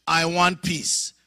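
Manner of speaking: a loud, drawn-out demand; insistent demand. Retold or imitated. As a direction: press for it, insistent. Demanding peace after the prayer.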